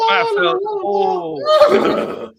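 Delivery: high, loud bleating goat voice. Acted. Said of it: "[name] does a solid goat impression".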